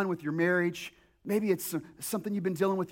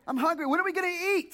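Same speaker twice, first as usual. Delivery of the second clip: higher, whining question; whiny hungry kid; 8 semitones higher, much faster than usual. Retold or imitated. Pastor mimicking a hungry child on a trip, whining higher. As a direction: nag like a bored child.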